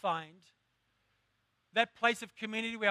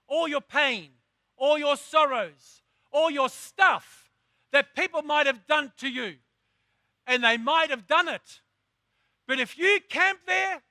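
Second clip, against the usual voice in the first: preaching at full force; 4 semitones higher, 8 dB louder than usual. Acted. Preacher urging the congregation to rise up, full-force preaching. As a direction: preach it hard, hammer every phrase.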